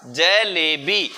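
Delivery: stretched syllable by syllable, je-le-bee, in जलेबी; जलेबी said very slowly for spelling; sounded out slowly. Shown as a demonstration. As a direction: draw out each long vowel of जलेबी. Teacher sounding out corrected word.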